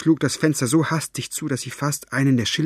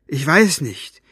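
higher, pressed-out answer; blurted out; 5 semitones higher, 5 dB louder, slower than usual. A narrator's reading. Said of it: "stieß Maggie hervor".

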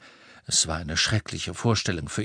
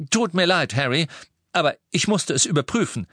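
brisk, raised-voice apology; curt; 8 semitones higher, 3 dB louder, faster than usual. A narrator's reading. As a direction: apologise curtly and move on. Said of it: "sagte Lupin kurz angebunden".

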